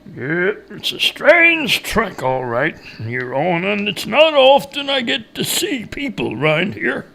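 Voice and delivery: slow backwoods character, swinging up high; knowing woodcutter. A narrator's reading. Woodcutter confirming the track is strange, knowing character voice. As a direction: agree slowly in a hillbilly voice.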